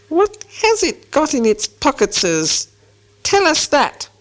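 slow, high creature hiss; hissing Gollum. A narrator's reading. Gollum out of the gloom suspects Bilbo, hissing. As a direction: draw it out high, hissing the s sounds. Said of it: "came a sharp hiss".

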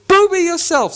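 put-on very high, loud childish comeback; troll retort. Tom insulting William back, high squawking troll. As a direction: spit it right back. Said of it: "said Tom".

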